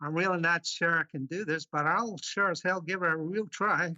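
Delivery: put-on Scotsman putting on an American accent; best American accent. Guest warming up for the challenge, put-on American accent. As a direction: try on a broad American voice. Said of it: "your best American accent".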